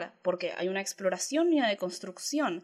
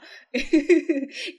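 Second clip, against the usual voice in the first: higher giggle breaking out; 7 semitones higher, 6 dB louder than usual. Real, not acted. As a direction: laugh it off lightly. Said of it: "Yo me río".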